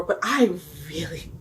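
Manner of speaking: usual speaking voice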